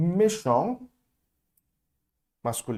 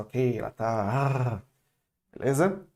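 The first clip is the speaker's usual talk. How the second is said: usual speaking voice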